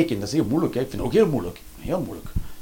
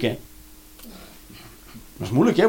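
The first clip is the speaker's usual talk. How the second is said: ordinary talk, nothing marked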